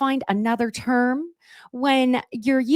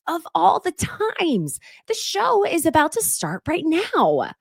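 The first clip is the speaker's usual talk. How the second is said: imitating Kathy; 8 semitones higher, slower than usual. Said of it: "she was like".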